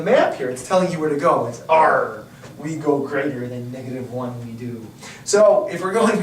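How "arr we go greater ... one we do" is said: pirate voice. Acted. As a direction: play a salty pirate captain. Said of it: "stop with that pirate voice now".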